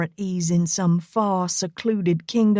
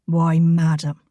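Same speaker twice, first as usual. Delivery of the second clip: impish Irish brogue, lower; 3 semitones lower than usual. Acted. Rigo baiting Elena with a joke, impish Irish brogue. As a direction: lead her on with a sly Irish lilt.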